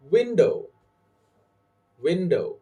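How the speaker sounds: "window" with a high WIN, falling away; stress on first syllable. Shown as a demonstration. Teacher saying words for learners to repeat, first syllable stressed. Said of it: "I will say the word and you repeat".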